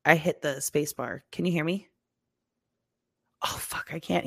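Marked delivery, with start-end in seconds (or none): 3.4-4.2 urgent panicked whisper. Real, not acted. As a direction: hiss it under your breath in alarm. Tech panic mid-recording.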